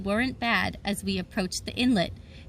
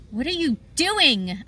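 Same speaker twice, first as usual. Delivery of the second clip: slow, mildly angry yell, higher; furious yell; 5 semitones higher, 3 dB louder than usual. A narrator's reading. Narrator yelling at his stowaway sister, mildly furious. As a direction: scold her in disbelief. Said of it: "I yelled at her".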